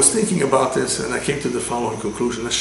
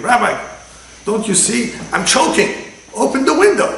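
much higher, louder whiny complaint; complaining passenger; 10 semitones higher, 6 dB louder than usual. Retold or imitated. Rabbi acting the train smoker, high, loud and whining. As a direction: whine and demand like an annoying man.

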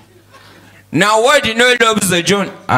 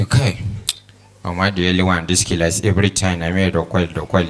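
mock pupil reading; 13 semitones lower, 4 dB quieter than usual.

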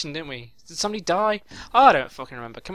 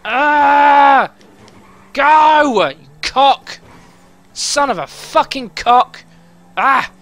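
higher, louder furious yells; angry shout; 5 semitones higher, 6 dB louder, much slower than usual. Real, not acted. Player yelling at a rival driver, angry shouting. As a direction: bark curses at the screen. Raging at game opponent.

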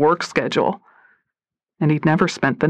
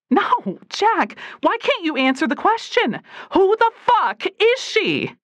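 angry demand; 13 semitones higher than usual. Acted. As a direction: confront him, voice rising high. Katie confronting Jack.

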